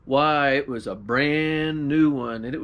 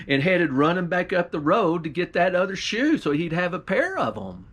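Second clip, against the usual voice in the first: usual speaking voice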